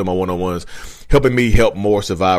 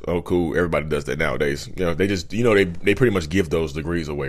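low, softer, unimpressed brush-off; mildly dismissive; 6 semitones lower, 4 dB quieter than usual. Acted. Narcissist answering a graduate's good news, mildly dismissive. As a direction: wave the good news away, voice dropped.

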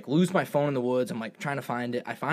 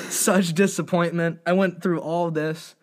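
ordinary delivery

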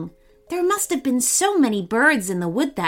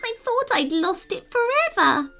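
relieved fairy; 12 semitones higher than usual. Acted. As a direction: sigh it out as the fairy. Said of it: "trembling with relief".

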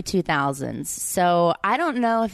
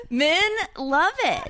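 gleeful; 11 semitones higher, slower than usual. Real, not acted. Gushing about compliments.

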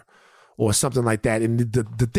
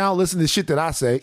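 usual speaking voice

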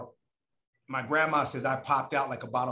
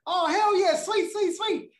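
much higher, gushing hell yeah; excited girlfriend voice; 4 dB louder, slower than usual. Retold or imitated. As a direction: squeal like a thrilled girlfriend. Girlfriend voiced in retold story.

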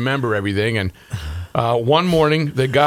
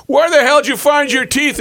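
mock yell; 12 semitones higher, 5 dB louder than usual. Uncle yelling at wife, retold.

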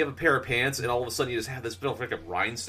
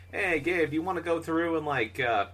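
higher, friendly put-on voice of a coworker; imitating a coworker; 8 semitones higher than usual. Retelling an old coworker's message offering him stuff, higher voice. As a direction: read her message as a cheery offer. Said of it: "she sends me a message".

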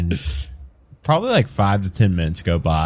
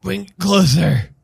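put-on decrepit old vampire; 9 semitones higher, 3 dB louder than usual. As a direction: draw it out high, like an aged vampire. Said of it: "decrepit looking vampire with a walking stick".

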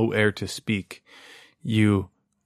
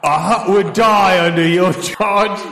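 louder, higher harsh father voice; stern disapproving father; 7 semitones higher, 4 dB louder than usual. Acted. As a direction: berate him like a cold father. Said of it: "Can you be my father who's telling me bad things?".

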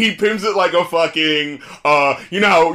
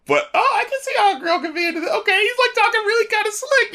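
put-on high, airy falsetto girl voice; imagined girl's voice. Friend voicing a girl swooning over the rapper. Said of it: "but it's like".

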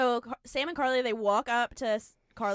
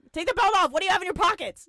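loud, higher security officer demand; mock screaming; 7 semitones higher, 8 dB louder, faster than usual. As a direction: join in yelling orders at a traveler. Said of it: "who's just screaming at you".

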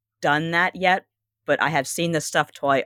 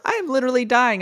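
mock dying NPC; 8 semitones higher than usual. NPC begging melodramatically.

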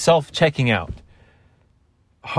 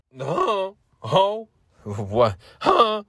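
high startled squeak; 10 semitones higher, much slower than usual. Retold or imitated.

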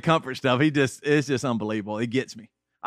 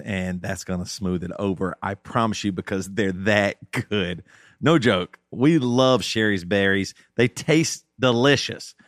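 ad read; 4 semitones lower than usual. Acted. Sponsor read for Sherry's Berries.

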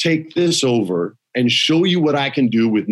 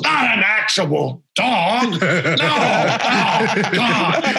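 exasperated boss; 6 semitones higher, slower than usual. Retold or imitated. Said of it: "Vince goes".

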